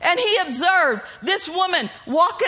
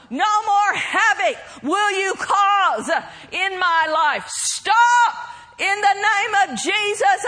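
bold shouted command; 3 semitones higher, slower than usual. Shown as a demonstration. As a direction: shout it down with boldness. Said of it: "Let's practice".

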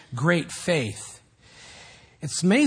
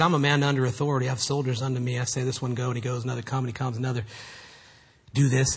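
low, hushed, matter-of-fact quote; quiet humble recital; 6 semitones lower, 5 dB quieter, faster than usual. Retold or imitated. Centurion explaining authority to Jesus, low and quiet. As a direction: voice a soldier stating his rank softly and low.

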